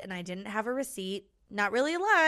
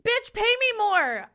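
mock demand; 13 semitones higher, 7 dB louder than usual.

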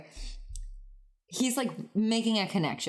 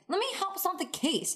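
mocking the daughter; 13 semitones higher than usual. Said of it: "she's just like".

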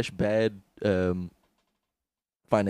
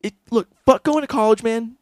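higher, pushy hustle pitch; Gary Vee impression; 8 semitones higher than usual. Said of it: "amazing Gary Vee".

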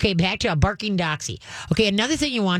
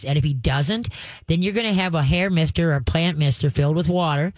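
ordinary talk, nothing marked

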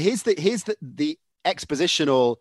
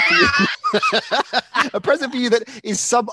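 laughing; 10 semitones higher than usual. Real, not acted. Laughing while announcing gift.

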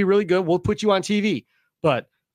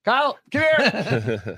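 drawn-out, slow acted call to someone; mock summoning; much slower than usual. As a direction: call a player over, stretching the words. Acting out summoning a better bowler.

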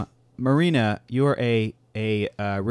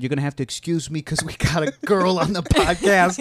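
louder, higher New York wiseguy; mock New York gangster announcing a girl guest; New York mobster accent; 6 semitones higher, 5 dB louder than usual. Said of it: "in that accent".

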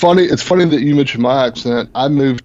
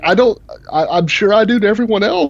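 incredulous retort; 9 semitones higher than usual. Retold or imitated. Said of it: "I was like".

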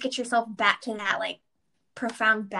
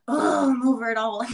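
fed-up groan; 5 dB louder than usual. Acted. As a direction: groan it out, overwhelmed and done. Acting out being stressed and fed up.

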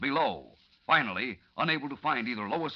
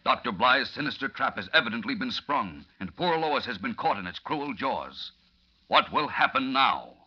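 dark ominous narrator; dramatic narration; 5 semitones lower than usual. A narrator's reading. Narrator's cliffhanger.

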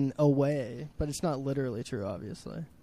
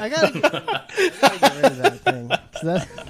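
laughing; 12 semitones higher, 8 dB louder than usual. Real, not acted. Caught with embarrassing picture.

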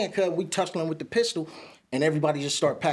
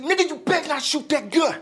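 high warning yell; 10 semitones higher than usual. Retold or imitated. Warning gunman while running.

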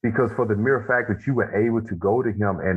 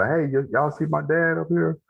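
higher-pitched retold question; re-enacted questioning, pitched up; boy asking around; 8 semitones higher than usual. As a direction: imitate a kid calling out to passersby. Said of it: "I was asking people".

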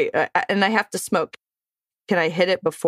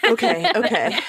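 nervous laughing; 3 semitones higher than usual. Real, not acted. Nervous laugh before segment.

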